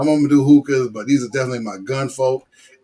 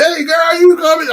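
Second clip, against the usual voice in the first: high, loud mock catcall; creepy stalker call; 7 dB louder than usual. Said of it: "be like one of them stalkers".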